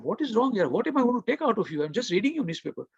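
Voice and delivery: high-pitched retold protest; taken aback. Rebuffed man protesting about a fellow passenger's newspaper, taken aback. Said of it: "I was so taken over".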